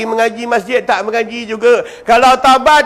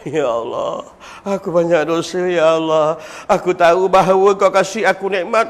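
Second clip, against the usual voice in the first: crying voice; 4 semitones lower than usual. Shown as a demonstration. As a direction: pray with a broken, weeping voice. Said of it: "Buat-buatlah suara orang menangis". (suara doa menangis yang rendah dan perlahan)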